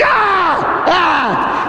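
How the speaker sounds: repeated high shouts of exertion; imitated attack yells. Centurions taking turns striking, high attack yells. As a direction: yell with each swing of the whip. Soldiers run up swinging the scourge.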